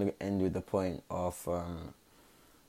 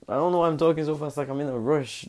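fast, high and louder rattle; rushed; 7 semitones higher, 4 dB louder than usual. Real, not acted. A vlogger catching himself rushing, said fast. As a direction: gabble it out in a rush.

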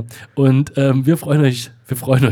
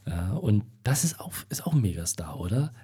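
whispering; 4 semitones lower, 8 dB quieter than usual. Real, not acted. Whispered aside about the singer.

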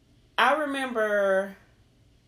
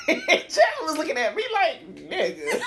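laughing; 11 semitones higher, 4 dB louder than usual. Real, not acted. Laughing retelling Chad.

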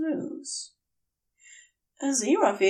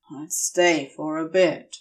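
lower, a touch grudging; begrudging; 5 semitones lower than usual. Acted. Crowley mildly begrudging, offering to stay with Aziraphale. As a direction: offer it as if it costs him. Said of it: "The words sound begrudging".

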